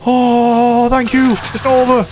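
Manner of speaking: high, loud relieved cheer; excited relief. Real, not acted. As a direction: burst out with real relief. Teammate saves him.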